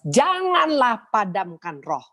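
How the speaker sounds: high, drawn-out preaching cry; emphatic command. Acted. Preacher warns the sisters with a scripture, emphatic command.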